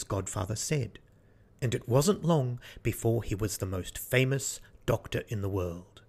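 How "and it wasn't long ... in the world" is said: ordinary delivery, nothing marked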